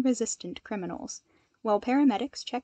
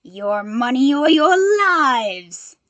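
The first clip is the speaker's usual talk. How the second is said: loud, slow, gleeful villain shout; a robber relishing the holdup, booming slowly; 3 semitones higher, 13 dB louder, slower than usual. A narrator's reading. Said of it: "a man's voice said gleefully".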